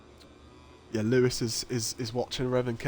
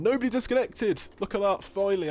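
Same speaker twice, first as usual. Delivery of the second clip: higher-pitched, excited announcement; relieved excitement; 6 semitones higher, 3 dB louder than usual. Real, not acted. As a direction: celebrate that it finally worked. Race finally starts without disconnections.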